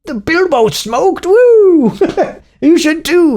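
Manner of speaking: high, loud whoop in a mock Malcolm voice; hammed-up cheer. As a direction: cheer him on like a giddy fan.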